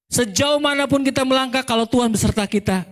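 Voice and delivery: preaching uplift. Acted. Preaching at full force.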